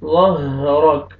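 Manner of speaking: ظہرک with heavy ظ and thick ر, کاف stopped. Shown as a demonstration. Teacher models heavy letters in ظہرک. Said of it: "را کو موٹا کر کے پڑھیں گے".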